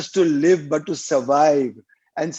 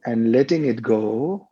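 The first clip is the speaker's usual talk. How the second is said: lower-pitched meditation voice with long pauses; guided meditation; 4 semitones lower than usual. Real, not acted. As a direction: guide listeners through each breath, drawing out the cues. Leads a guided breathing meditation.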